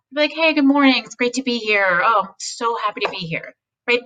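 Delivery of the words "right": usual speaking voice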